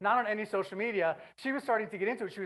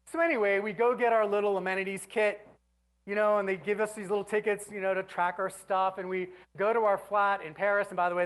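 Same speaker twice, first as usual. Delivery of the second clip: ordinary talk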